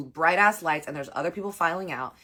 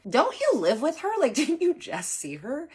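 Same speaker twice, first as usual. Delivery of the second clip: high, louder disbelieving laugh; you can't be serious, laughing at him; high incredulous retort with a laugh; 10 semitones higher, 4 dB louder than usual. Retold or imitated. Incredulous quote of own retort.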